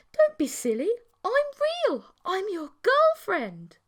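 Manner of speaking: put-on high playful girlfriend. A narrator's reading. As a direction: coax him sweetly. Creature insisting she's real.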